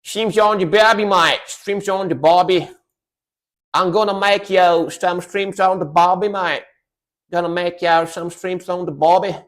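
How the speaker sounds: hammed-up Aussie accent; Australian accent. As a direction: put on a playful Australian accent. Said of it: "That's Australian, though".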